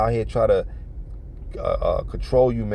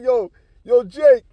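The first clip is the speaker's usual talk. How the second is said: loud, high-pitched "yo"; startled calling out; 13 semitones higher, 7 dB louder, slower than usual. Retold or imitated. Onlooker calling to his friend after the insult, startled. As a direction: act out calling a friend in disbelief.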